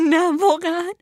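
high-pitched tearful wail; sobbing. Acted. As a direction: say it through sobs, distraught.

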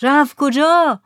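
high, loud shouted question; calling from the boat. A narrator's reading. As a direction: yell the question to the shore. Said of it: "از روی جهاز گفتند". (فریاد پرسشی از دور)

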